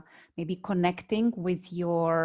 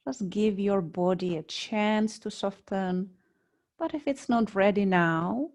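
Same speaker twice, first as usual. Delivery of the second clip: guided meditation. Real, not acted. Yoga teacher guiding students lying on mats, calm meditation voice. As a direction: lead them unhurriedly into body awareness, phrase by phrase.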